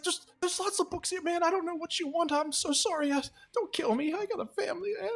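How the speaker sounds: very high panicked begging; near-tears pleading. Acted. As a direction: babble for mercy, about to cry. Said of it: "he starts crying".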